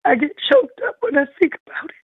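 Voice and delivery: tearful, breath catching on a sigh; fighting back real tears; choked up crying. Real, not acted. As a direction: voice breaking with emotion. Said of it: "I apologize for crying".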